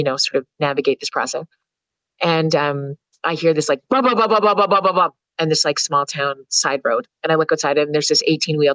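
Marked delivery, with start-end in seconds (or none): 3.9-5.1 mock truck engine blah blah; playful truck noise; imitated engine rumble. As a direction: rumble it out like a big rig pulling up.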